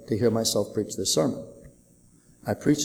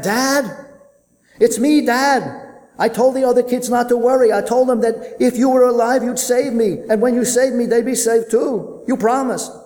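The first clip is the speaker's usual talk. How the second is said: put-on high, louder voice of a trapped boy; young son calling up from rubble; child's voice; 12 semitones higher, 9 dB louder than usual. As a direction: play the relieved little son. Trapped son answers his father.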